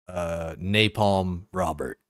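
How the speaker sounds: usual speaking voice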